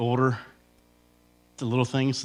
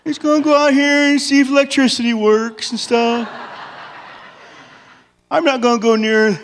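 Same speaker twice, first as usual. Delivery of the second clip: put-on four-year-old; 7 semitones higher than usual. Husband caught sneaking snacks, talking like a guilty little kid. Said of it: "I turn into a four-year-old".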